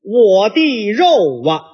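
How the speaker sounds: slow, deliberate syllable-by-syllable recital; counting syllables. Shown as a demonstration. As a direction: count out each word of the lament. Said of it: "我给您数数啊".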